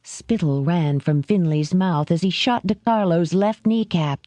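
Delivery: plain reading voice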